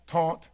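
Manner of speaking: "Taunt" said low and soft, showing off the accent; accented word. Shown as a demonstration. Preacher points out own accent to the congregation, quietly accented "Taunt". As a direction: say "Taunt" quietly, in isolation, for the listeners. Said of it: "get my accent?".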